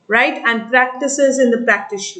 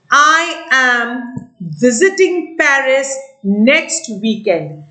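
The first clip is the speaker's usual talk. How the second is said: slow dictation; slower than usual. Shown as a demonstration. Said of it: "she said".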